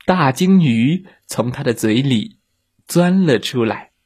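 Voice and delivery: ordinary delivery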